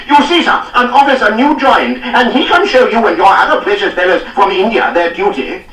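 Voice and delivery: high, loud gloating tirade; triumphant scolding. A narrator's reading. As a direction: crow over the captain as you scold. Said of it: "he turned in triumph on Reynolds".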